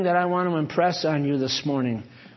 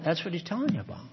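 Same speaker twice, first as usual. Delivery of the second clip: normal talking voice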